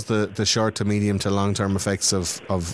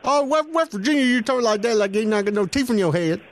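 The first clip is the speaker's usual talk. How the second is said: high-pitched hammed-up West Virginia accent; 12 semitones higher than usual. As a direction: play a toothless West Virginia yokel, way up high. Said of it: "I'll try and do the accent".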